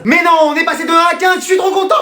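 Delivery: high-pitched, excited squeal; overjoyed. Acted. Pupil hears he got fifteen, overjoyed squeal.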